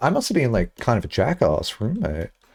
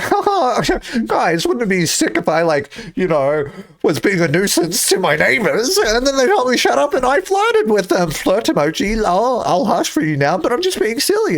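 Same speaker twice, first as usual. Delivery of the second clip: high, giddy rapid-fire babble; pestering jokester; 10 semitones higher than usual. Retold or imitated. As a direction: act out an over-eager joker, pitched way up. Acting out her bits for roommates.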